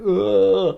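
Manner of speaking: loud high ugh of disgust. Acted. Groaning at the inner critic's insults, high and loud.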